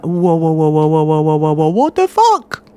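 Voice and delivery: mock a streamer overreacting; hype streamer whoa. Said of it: "best press continue impression".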